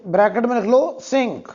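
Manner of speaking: usual speaking voice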